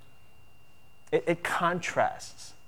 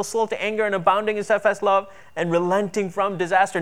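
raised, urgent preaching read; preaching at full force; 5 semitones higher, 4 dB louder than usual. Acted. Preacher reads Jonah's complaint to the congregation, forceful. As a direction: proclaim it higher, pressing on.

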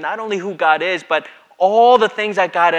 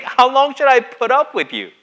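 exasperated; 9 semitones higher, 6 dB louder than usual. Retold or imitated. Repeated exasperated quote.

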